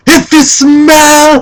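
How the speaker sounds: high, stretched-out catchphrase shout; The Rock. Acted. Fan retelling The Rock's surprise return, high drawn-out catchphrase. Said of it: "and all I hear is".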